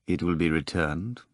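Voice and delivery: reply quietly, keep it down. A narrator's reading. Rimsky answering a doubting colleague about money, quietly. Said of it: "Rimsky replied quietly".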